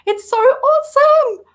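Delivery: very high, loud fangirl squeal; loud, high-pitched swoon; gushing delight. Real, not acted. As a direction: squeal with adoration. Gushing over his voice and accent.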